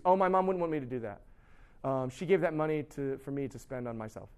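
low, trailing-off mock excuse; sheepish excuse. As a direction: fumble softly for an excuse. Voices a student making up an excuse.

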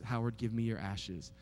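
soft, low and tender; gentle voice of God. Retold or imitated. God asks Howard for his ashes, soft and tender. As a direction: call him by name, gently.

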